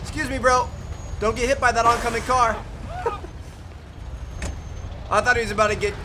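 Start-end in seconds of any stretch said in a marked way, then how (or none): 0.0-2.6 higher, louder call to the passerby. Real, not acted. Warning NPC about a car. 2.6-5.1 sudden airy laugh at the near-miss; high breathy laugh. Real, not acted.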